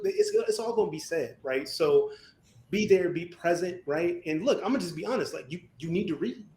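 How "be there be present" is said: normal talking voice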